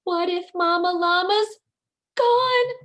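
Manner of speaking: put-on baby llama alone in the dark, afraid mama is gone; scared. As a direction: let the worry climb to a high, loud gone.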